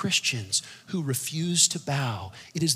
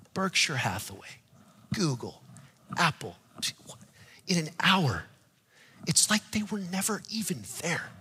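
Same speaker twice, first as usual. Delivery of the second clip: hushed preaching. Acted. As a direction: hush the church to a secret. Sudden drop to hushed tones.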